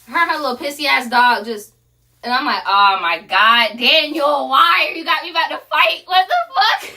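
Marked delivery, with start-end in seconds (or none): 2.7-6.9 rising, louder, ending in a high squeal; exasperated yelp climbing to a shriek; exasperated outburst. Retold or imitated. Wife exasperated at husband nearly starting a fight, rising squeal.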